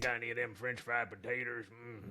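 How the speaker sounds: Sling Blade voice. Retold or imitated. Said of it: "Have you ever seen the movie Sling Blade".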